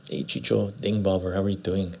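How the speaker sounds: ordinary delivery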